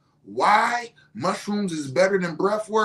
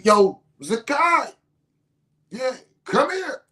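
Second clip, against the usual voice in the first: raised, higher calling voice; 7 semitones higher, 6 dB louder, slower than usual. Retold or imitated. Father calling his son down from upstairs, calling out. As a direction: summon someone from another floor, acted out.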